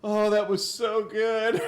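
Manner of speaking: high breathless laughter, slow words; gasping between fits of laughter; laughing hard. Real, not acted. Still laughing after the joke.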